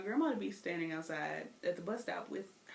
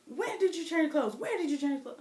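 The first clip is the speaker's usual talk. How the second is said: higher, louder demanding grandmother; stern grandma; 7 semitones higher, 5 dB louder than usual. Retold or imitated. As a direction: press the question like an upset granny. Grandma interrogating the child.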